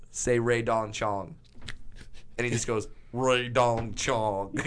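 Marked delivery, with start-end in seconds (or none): 3.1-4.6 put-on very slow, drawn-out manager's accent; Barry Katz accent. Comedian mimicking manager saying one name, drawn-out accent. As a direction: stretch each word in the manager's accent. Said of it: "he says it in this accent".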